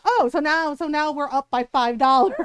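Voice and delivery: loud, sarcastic mock indignation; loud retold angry sarcasm; sarcastic outrage. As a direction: imitate a husband scoffing loudly at an offer. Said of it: "And Ron's like".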